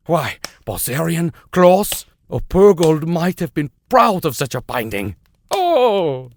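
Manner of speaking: high and loud, gushing professor; rapid admiring outburst. A narrator's reading. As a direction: burst out in delight over the binding. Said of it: "While rapidly making these comments".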